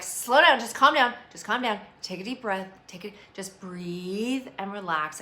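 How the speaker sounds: loud, urgent calm-down plea; mock calming shout. Someone soothing a panicking friend, loud and urgent. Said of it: "they're like".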